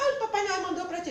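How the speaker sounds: put-on a touch of a doting aunt to a little boy; aunt's voice. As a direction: cheerfully tell the child Santa sent it. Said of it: "as tias dele chegavam assim".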